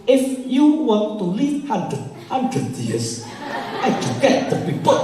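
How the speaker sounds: put-on English with a heavy Javanese accent, slow and high. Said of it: "pronunciation orang Jawa". (bahasa Inggris logat Jawa, lambat)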